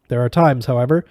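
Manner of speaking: plain reading voice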